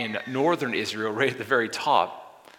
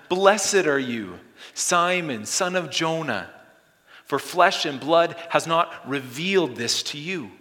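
emphatic blessing; 5 semitones higher, 5 dB louder than usual. Acted. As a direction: bless him with force. Jesus blessing Peter, emphatic.

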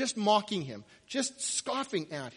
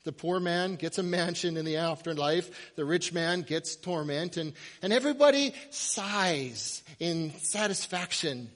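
usual speaking voice

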